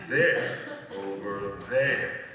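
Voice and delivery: first "There" punched loud, "over there" drawn out slowly; pointing emphasis. Shown as a demonstration. Narrator showing where to point, with a loud "There". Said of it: "You might want to point to that".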